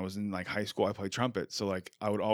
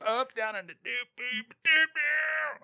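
high-pitched tooting mouth trumpet; mimed trumpet noises; 14 semitones higher, slower than usual. Shown as a demonstration. Host showing air trumpet with three fingers, high tooting. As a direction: sing out little doops like trumpet notes. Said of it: "your three fingers to your mouth and just go".

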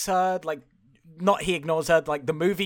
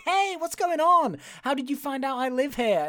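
much higher, put-on surprised voice; mock startled greeting; 8 semitones higher than usual. Woman finding her patient at the door, mock startled. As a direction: question the visitor in hammed-up surprise. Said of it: "Is like".

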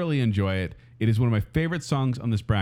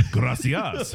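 put-on Spanish accent. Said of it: "Your French accent".

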